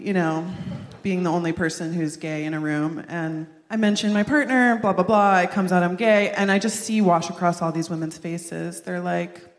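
ordinary delivery

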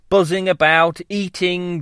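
ordinary delivery, nothing marked

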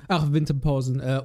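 ordinary talk

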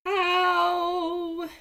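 high, long painful wail. A narrator's reading. Seagull wailing as Raven tugs the spines, high long wail. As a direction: cry out in pain. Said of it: "wailed Seagull".

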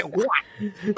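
high, drawn-out punch noise; spoken punch sound. Retold or imitated. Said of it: "He goes".

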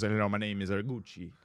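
put-on Italian accent. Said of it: "perfect Italian accents".

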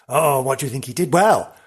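ordinary talk, nothing marked

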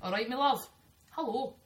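lilting Scottish greeting; playful Scottish impression; Scottish accent. Shown as a demonstration. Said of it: "Scottish. Last one".

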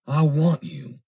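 high, loud declaration of longing; impassioned plea. A narrator's reading. Foster pleading his love to Irene, impassioned.

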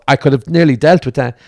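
usual speaking voice

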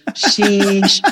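Sheesh with a long, held ee; name pronunciation. Shown as a demonstration. Guest demonstrating her surname, one long clear syllable. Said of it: "think of it as like".

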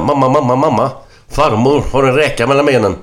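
put-on high, loud, urgent child's call; excited little boy. Little boy running to his mother, excited. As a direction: burst in with big news for mum. Lille Per runs to mother.

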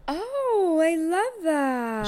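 gushing. Real, not acted. Gushing reaction.

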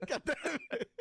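high, giggly quote of the clip; imitated curse dissolving into laughter; laughing movie-line imitation. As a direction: mimic the line while cracking up. Quotes the clip while cracking up.

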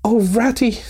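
high cry of mild despair; Mole, despairing. A narrator's reading. Host suddenly remembers the empty larder, despairing. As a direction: break off into a high, dismayed appeal. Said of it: "he cried in despair".

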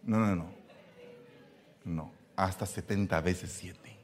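normal talking voice

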